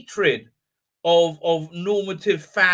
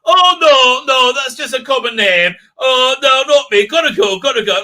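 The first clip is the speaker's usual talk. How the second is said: loud, high, hammed-up protest; mock flustered brush-off; unconvincing denial; 7 semitones higher, 8 dB louder than usual. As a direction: deny it far too hard, badly faking. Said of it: "in a very unconvincing way".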